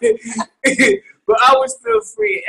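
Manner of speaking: high-pitched giggling through words; can't stop laughing while talking. Real, not acted. Laughing fit after false start.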